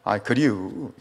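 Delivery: relaxed Chungcheong regional speech; Chungcheong dialect. Acted. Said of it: "충청도 말이 튀어나오는 거예요".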